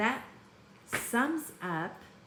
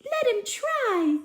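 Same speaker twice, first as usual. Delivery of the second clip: much higher, bossy princess command; 7 dB louder than usual. Acted. Teacher rereads the princess ordering Cinder to try. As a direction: squeak the order up high like the princess. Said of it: "can I reread that part?".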